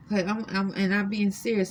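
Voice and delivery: usual speaking voice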